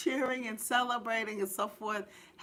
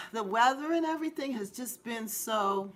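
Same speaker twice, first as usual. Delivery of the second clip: ordinary talk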